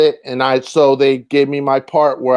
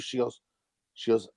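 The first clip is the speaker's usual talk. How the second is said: ordinary delivery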